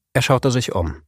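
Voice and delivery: plain reading voice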